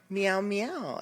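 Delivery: cat meow. Shown as a demonstration. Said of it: "What does the kitty cat say?".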